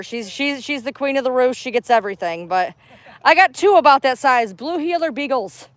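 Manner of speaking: pitched well up, sweet and friendly; friendly stranger chat. Real, not acted. Greeting a dog owner at the park, high friendly voice. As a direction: lift the voice high to charm a passer-by.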